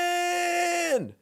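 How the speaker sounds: high, long mock scream; imitated scream. Host imitating the guys yelling from a bridge, high drawn-out scream. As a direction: holler the name out, drawn out and high. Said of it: "and they scream".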